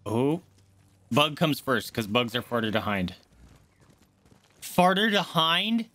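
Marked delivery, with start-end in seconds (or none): 4.6-5.9 incredulous self-mockery. Real, not acted. Streamer catching their own slip, slow incredulous question. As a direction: repeat your own slip in disbelief.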